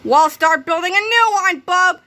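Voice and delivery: loud, high commanding shout; bossy shout. A narrator's reading. Croppy ordering Mister Melvin, loud high shout. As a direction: order him around. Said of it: "shouted Croppy the Kid".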